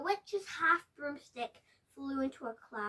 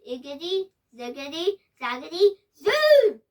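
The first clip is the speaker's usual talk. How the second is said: building spell; 2 semitones higher, 13 dB louder than usual. Acted. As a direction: cast the spell with growing power. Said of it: "she muttered a spell".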